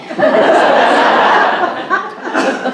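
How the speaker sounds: high breathy burst of laughter; loud laugh. Real, not acted. Speaker laughs at her own campaign story, loud and high. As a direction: laugh out at the joke.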